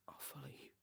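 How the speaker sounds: barely audible breathy whisper; faint, voiceless resignation; surrendering whisper. A narrator's reading. Broken man surrenders before death.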